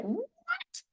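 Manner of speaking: very high disbelieving squeak; shocked what. Retold or imitated. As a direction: squeak it out, utterly baffled. Told they can't talk.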